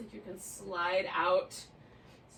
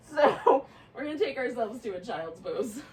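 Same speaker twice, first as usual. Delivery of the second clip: louder, laughing through it; 2 semitones higher, 10 dB louder than usual. Real, not acted. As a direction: keep laughing as you talk. Still laughing after stretch.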